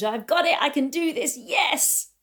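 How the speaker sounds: high, exultant self-cheer; thrilled, pitched well up; big excitement. Retold or imitated. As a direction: build to a jubilant yes. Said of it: "getting into the big excitement".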